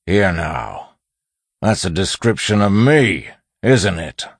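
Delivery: menacing bandit. A narrator's reading. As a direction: confront the captive over the notes. Bandit confronts captive over notes.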